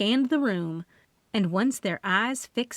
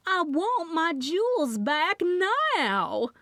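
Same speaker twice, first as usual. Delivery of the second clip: high, slow, drawn-out demand; a rich woman screeching through the door; Loretta shrieking; 10 semitones higher, much slower than usual. Acted. Loretta screeches at the cop through the glass door.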